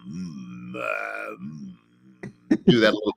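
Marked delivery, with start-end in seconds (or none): 0.1-1.7 low, slow growl from the back of the throat; throat growl demo. Shown as a demonstration. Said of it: "So I kind of have to growl".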